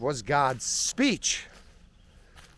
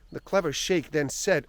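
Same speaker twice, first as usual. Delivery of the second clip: usual speaking voice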